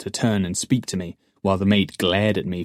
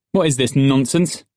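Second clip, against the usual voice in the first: loud, higher-pitched angry question; angry demand; 5 semitones higher, 6 dB louder than usual. A narrator's reading. Justin challenges the oarsman before the master, angry demand. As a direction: demand an explanation, angrily. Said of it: "Justin demanded angrily".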